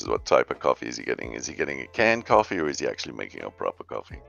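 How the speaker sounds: ordinary delivery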